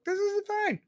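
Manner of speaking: "This is fine" swooping up high, mocking; sarcastic sing-song. Ranting host mocking people who ignore crime, sarcastic high "This is fine". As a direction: sneer "This is fine" like nothing's wrong. Sarcastic line, then said plainly.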